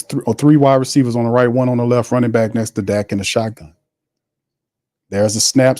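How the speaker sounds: usual speaking voice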